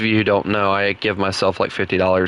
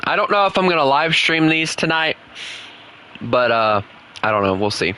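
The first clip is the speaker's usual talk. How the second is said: normal talking voice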